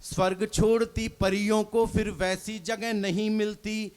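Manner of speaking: measured recited couplet, each line dwelt on; poem recitation. Acted. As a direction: recite it like a stage poet. Poet reciting verse on stage.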